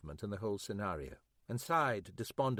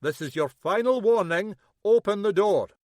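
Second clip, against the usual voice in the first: loud, higher final warning; stern police warning; 8 semitones higher, 10 dB louder than usual. A narrator's reading. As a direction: command through a locked door. Police warning through door.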